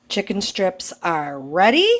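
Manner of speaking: normal talking voice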